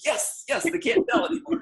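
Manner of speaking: secretly thrilled, laughing to yourself; gleeful inner thought. Retold or imitated. A missionary delighted nobody guessed he was American. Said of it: "I'm thinking".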